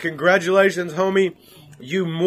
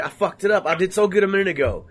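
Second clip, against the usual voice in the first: ordinary talk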